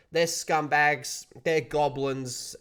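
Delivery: slow, spat-out insults; contemptuous. Real, not acted. Vlogger calling Blizzard scumbags to his audience, slow and contemptuous. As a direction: drag each insult out with scorn.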